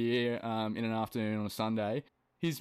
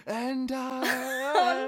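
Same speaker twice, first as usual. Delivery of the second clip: mock belted order; 5 dB louder than usual. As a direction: belt a coffee order like a diva. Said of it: "Just, like, order your coffee like that".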